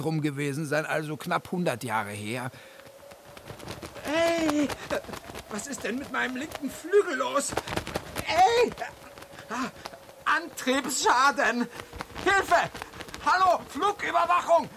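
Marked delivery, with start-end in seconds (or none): 4.1-14.7 high, loud panicked radio call; frantic pilot losing control; panicked mayday. Acted. Owl crash-landing, panicking on radio.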